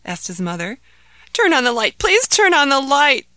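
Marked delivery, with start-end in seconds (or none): 1.3-2.0 begging. Acted. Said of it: "begged Ahmed". 2.0-3.3 higher, louder plea on Please; pleading. Acted. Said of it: "begged Ahmed".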